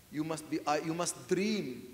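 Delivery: usual speaking voice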